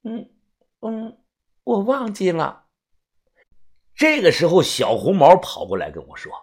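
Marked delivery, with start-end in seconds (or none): 0.0-2.7 put-on timid little girl, softly; shy and hesitant, barely audible; small quiet voice. A narrator's reading. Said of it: "小声地说".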